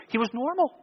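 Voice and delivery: quoting him in high, hushed surprise; retold surprise. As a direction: softly marvel on his behalf, pitch lifted. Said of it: "it genuinely surprised him".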